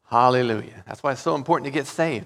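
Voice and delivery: ordinary delivery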